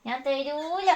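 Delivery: soft, teasing refusal; playful refusal. Pretend. Mother refusing to give up her baby, softly playful. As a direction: refuse quietly, as in a game.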